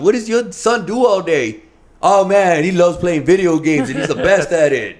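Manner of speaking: loud, high, animated boasting; mock bragging. Dad imagining bragging about his gamer son, mock-proud boasting. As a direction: gush as if showing off your kid.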